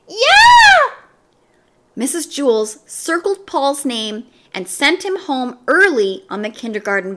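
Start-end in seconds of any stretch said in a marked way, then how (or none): 0.1-1.0 sudden piercing scream, much higher and louder. A narrator's reading. Leslie screams at the class, piercing yell. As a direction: Leslie shrieks out of nowhere. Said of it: "Leslie screamed".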